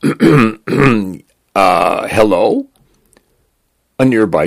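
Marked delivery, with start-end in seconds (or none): 0.0-2.7 tentative, higher-pitched hello with a questioning rise; mildly wary greeting, lifted at the end; hesitant reply. A narrator's reading. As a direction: answer uncertainly after clearing your throat. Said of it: "He cleared his throat".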